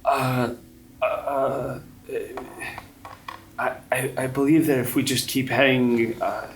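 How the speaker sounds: hesitant, flustered answer; nervous woman. Acted. Mary voiced nervously.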